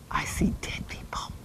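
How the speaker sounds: breathy unvoiced whisper, hushed and eerie; hushed creepy whisper; spooky whisper. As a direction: whisper it like a ghost-movie secret. Creepy movie-quote reveal.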